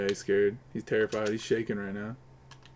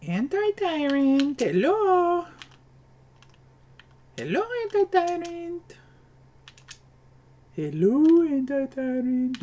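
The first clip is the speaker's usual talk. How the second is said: much higher, sing-song falsetto calling; coaxing falsetto; 14 semitones higher, slower than usual. Pretend. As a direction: coax the caller in a silly high voice. Coaxing a silent caller in falsetto.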